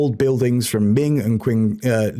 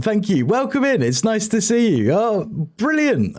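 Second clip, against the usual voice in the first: high bright welcome; excited greeting; 8 semitones higher than usual. Real, not acted. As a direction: greet them with a big high lift. Greeting a new chatter.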